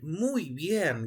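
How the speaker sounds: normal talking voice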